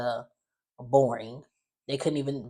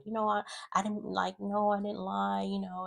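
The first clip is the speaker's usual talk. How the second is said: meek protest; 6 semitones higher, 6 dB quieter than usual. Retold or imitated. Voicing Eva protesting meekly.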